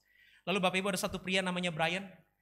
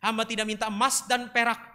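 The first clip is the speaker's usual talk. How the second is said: loud, steady, earnest praying; fervent prayer; 3 semitones higher, 7 dB louder than usual. Real, not acted. Preacher prays for the congregation, fervent and loud. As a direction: pray aloud with mounting intensity.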